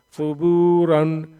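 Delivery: "सुबूरन" with loud, long बू and closing n; long waw madd. Shown as a demonstration. Teacher correcting the word's ending, stretched बू in "सुबूरन". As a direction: hold बू, then finish "सुबूरन" on रन. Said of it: "खींच करके पढ़ेंगे".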